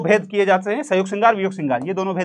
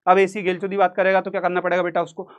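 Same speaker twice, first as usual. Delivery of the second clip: ordinary talk, nothing marked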